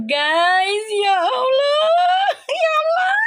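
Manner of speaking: shrieking high, half crying, half laughing; overwhelmed squeal of disbelief; excited crying shriek. Real, not acted. Streamer on live squealing at the cards, high and teary. Sees number seven come up.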